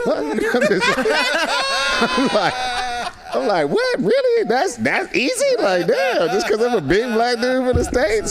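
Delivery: stunned that it's that easy; astonished disbelief. Retold or imitated. Retold own astonished reaction.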